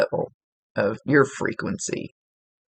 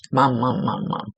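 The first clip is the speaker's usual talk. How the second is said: imitating a pestering child, understated; lightly droned, repetitive calls for mom; mild mock nagging; nagging kid. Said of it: "She's doing that".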